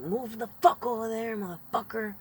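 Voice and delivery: high, loud furious yell at a stranger. Retold or imitated. To the smoking boat worker, a high loud furious yell. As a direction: blast it out, telling him to move. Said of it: "I just fucking yelled".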